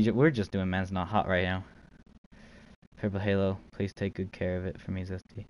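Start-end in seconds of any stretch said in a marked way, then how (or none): none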